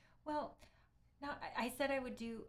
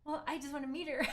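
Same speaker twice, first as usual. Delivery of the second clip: higher, louder, bubbling into a laugh; 6 semitones higher, 7 dB louder than usual. Real, not acted. Streamer eager to meet the harpist, laughing. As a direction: admit it with a laugh.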